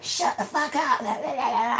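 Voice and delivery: high mock snapping into babble; mocking angry ex. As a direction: parody his tirade as high gibberish. Mimicking his angry reply.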